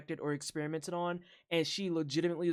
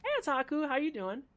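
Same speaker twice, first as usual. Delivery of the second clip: high playful greeting; 9 semitones higher than usual. Pretend. Greeting chat in a put-on voice.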